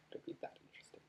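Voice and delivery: sarcastic whisper under the breath; a wry whispered afterthought; whispered aside. Real, not acted. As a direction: whisper it to yourself, dry. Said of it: "unvoiced whisper aside".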